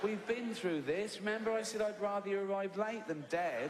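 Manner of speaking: a hint of a weary boss; mock patronising scold. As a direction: talk down to Ted like a child. Mock patient scolding of Ted.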